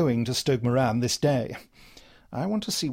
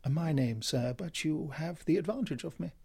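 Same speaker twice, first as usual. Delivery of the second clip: quiet, unruffled reply; understated, quiet courtesy; quietly; 5 dB quieter than usual. Acted. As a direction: answer the bully quietly. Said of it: "said my companion quietly".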